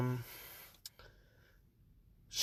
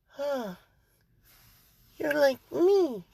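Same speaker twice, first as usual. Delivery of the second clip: put-on little girl; 11 semitones higher, slower than usual. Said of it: "So Eri, seeing Izuku's just like".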